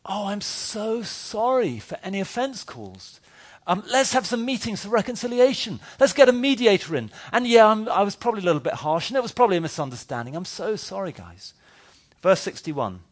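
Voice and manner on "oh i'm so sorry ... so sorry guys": a spineless pastor apologising for everything; mock groveling apology. Sarcastic preacher parodies an apologetic Jesus, high and simpering. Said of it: "what Jesus did NOT say, parody apology".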